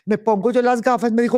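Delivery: usual speaking voice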